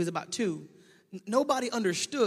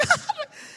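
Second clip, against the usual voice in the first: high mock laugh; 5 dB louder than usual. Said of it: "she's like".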